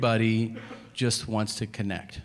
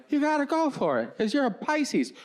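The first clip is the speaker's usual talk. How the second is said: high mock wisdom; 13 semitones higher than usual. Imitating horoscope believer.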